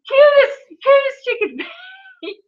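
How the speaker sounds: high, slow, cooing gush; gushing squeal. Real, not acted. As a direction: coo over her like she's adorable. Cooing over a little girl.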